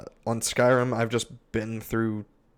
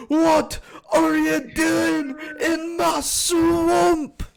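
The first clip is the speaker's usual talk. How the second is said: hollered, drawn-out, high-pitched ogre outrage; Shrek; 7 dB louder, much slower than usual. Acted. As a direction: bellow it slowly, stretching every word. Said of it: "I've got Shrek".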